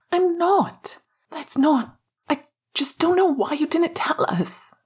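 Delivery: high-pitched agitated protest; upset, voice climbing high but not shouting. A narrator's reading. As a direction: protest with the pitch pushed right up. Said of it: "He had raised his voice".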